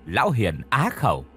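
ordinary delivery, nothing marked

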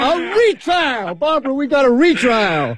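high, slow cry of joy; overjoyed. Acted. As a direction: shout the good news with delight. Overjoyed at the retrial news.

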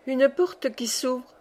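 plain reading voice

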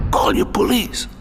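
ordinary delivery

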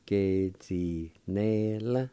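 Ge-zi-ne-la slowly, each syllable drawn out; syllable-by-syllable pronunciation. Shown as a demonstration. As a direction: sound out Ge-zi-ne-la at a teaching pace. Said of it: "pronounced like this".

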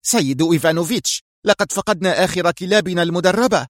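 high-pitched woman's report; female subordinate reporting. A narrator's reading. Mira radios her boss about the dogs, urgent report.

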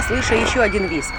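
ordinary delivery

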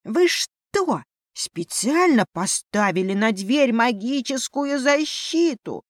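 высокий возмущённый вопль; заорать с порога, как обиженный зверёк; вопль мыши. Acted. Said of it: "и завопил". (громкий возмущённый вопль)

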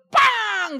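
loud high "Pang!" like a slap; slap sound. Retold or imitated. Preacher imitating the child's slap, a loud sharp smack. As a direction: smack the word out like a hand hitting.

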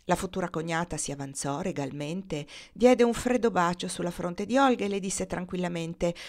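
ordinary delivery, nothing marked

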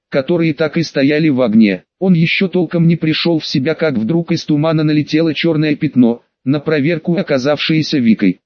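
plain reading voice

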